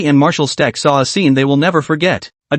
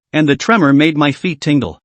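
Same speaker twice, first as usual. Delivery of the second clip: ordinary delivery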